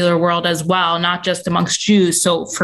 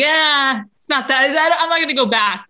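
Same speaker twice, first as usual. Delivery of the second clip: higher-pitched, mock-reluctant self-quote; 7 semitones higher than usual. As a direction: talk yourself out of it in a higher voice. Reluctant retold inner voice, backing out.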